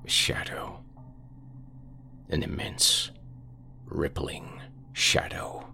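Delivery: hushed, breathless whisper; eerie whisper. Acted. Carl whispering what he sees outside the window, hushed. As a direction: whisper it like the thing might hear you. Carl describes the looming shadow.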